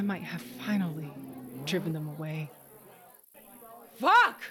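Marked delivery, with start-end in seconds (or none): 4.0-4.4 a high, loud, raw curse; furious yell. Acted. Tasha alone curses at herself, high furious yell. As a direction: scream it out in frustration.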